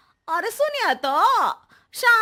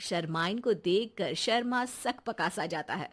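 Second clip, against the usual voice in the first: lower, softer narrator voice that fades away; story narration; 8 semitones lower, 8 dB quieter than usual. A narrator's reading. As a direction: tell it like a storyteller, dropping at the end. Narrator sets scene. (कहानी सुनाने वाली, नीची और धीमी आवाज़)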